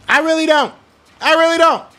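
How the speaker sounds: high, exasperated repeat; upset insistence. Real, not acted. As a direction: push it up in frustration. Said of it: "I'm just upset right now".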